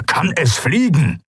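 slow, high, insistent demand; snorting impatience. A narrator's reading. Keru demands whether the ship can fly, impatiently. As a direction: press each word, pitch jumping up. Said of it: "schnaubte er".

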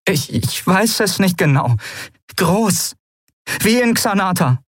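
high-pitched, halting urgency; urgent, rattled. A narrator's reading. Lien unsure how big the blast must be, rattled. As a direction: stumble at the start, then press on high. Frightened Lien answers under pressure.

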